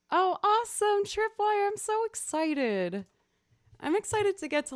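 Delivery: high, loud, beaming hello to Tripwire; excited greeting. Real, not acted. Streamer greets returning viewer Tripwire, high and excited.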